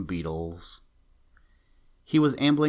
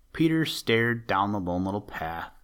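plain reading voice

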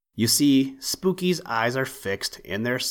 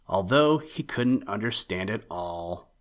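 plain reading voice

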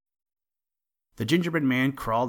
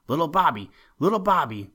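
put-on louder, higher calls for a child; calling out; 7 semitones higher, 7 dB louder, faster than usual. A narrator's reading. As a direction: call the little boy's name loudly. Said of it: "kept hearing people call".

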